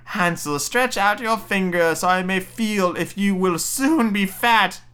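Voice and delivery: high, slow witch call. Acted. Witch calling to caged Hansel, high drawn-out cry. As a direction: call in to the prisoner, drawing it out high. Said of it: "crept to the little stable and cried".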